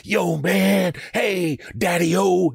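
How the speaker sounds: high, drawn-out seventies jive caricature; exaggerated high-pitched seventies slang voice. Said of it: "watch anything from the seventies".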